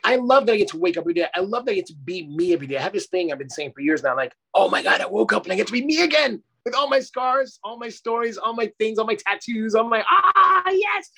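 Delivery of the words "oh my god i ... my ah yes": giddy, high-pitched morning rejoicing; high, delighted outburst; excited exclamation. Acted. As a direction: gush it, climbing to an ecstatic high cry. Acts out joy on waking up.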